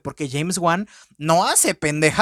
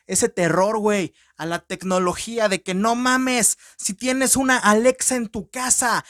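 ordinary talk, nothing marked

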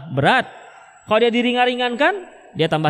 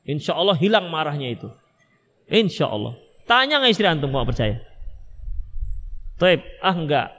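usual speaking voice

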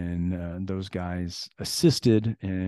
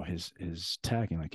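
normal talking voice

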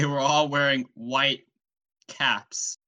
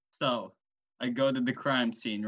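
usual speaking voice

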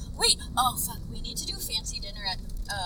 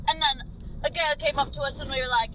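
light Scottish voice, a bit louder; imitating a nerdy Scottish guy; mimicking a Scottish lad; 5 semitones higher, 8 dB louder than usual. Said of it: "this guy's like, he's Scottish".

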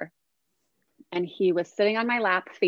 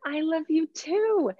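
higher, cooing I love you too; tender; 9 semitones higher than usual. Retold or imitated. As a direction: coo it to the baby. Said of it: "And so I said".

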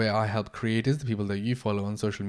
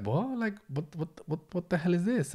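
high, quieter mock bewilderment, pitch jumping up; baffled disbelief; 7 semitones higher, 5 dB quieter than usual. Mocking the stiff interview talk.

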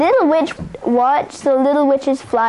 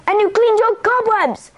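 high-pitched indignant scolding; angry; 8 semitones higher than usual. A narrator's reading. Mother Witch scolds Little Witch over cleaned cobwebs, angry. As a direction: scold in a raised, higher voice. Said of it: "Mother Witch was angry".

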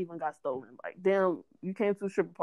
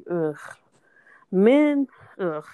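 exasperated groan; 8 dB louder, much slower than usual. Real, not acted. Exasperated sigh about men.

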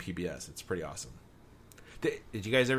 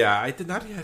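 normal talking voice

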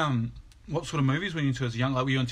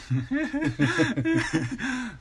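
a long, high laugh that falls away; 13 semitones higher, 4 dB louder than usual. Real, not acted. Host laughing at the guest's teenage lessons. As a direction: let a real laugh roll out.

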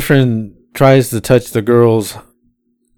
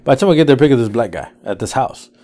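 usual speaking voice

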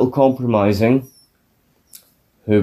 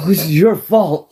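higher put-on voice, mock accusing; blaming voice; 6 semitones higher than usual. Man blaming his friend off camera, mock accusing. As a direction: pin the blame in a silly voice. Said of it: "he's like".

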